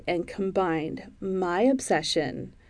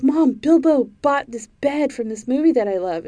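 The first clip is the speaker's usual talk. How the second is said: higher, louder plea to mom; excited retold complaint; 5 semitones higher, 5 dB louder than usual. Jealous fan complaining to mom about a movie bed, retold excitedly. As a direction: re-enact excitedly telling mom the news.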